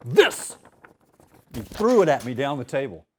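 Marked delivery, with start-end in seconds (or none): 0.1-0.6 loud, higher snap at the page; mock angry bark. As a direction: act out the teacher's outburst. Furious teacher throws manuscript.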